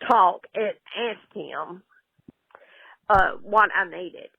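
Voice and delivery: normal talking voice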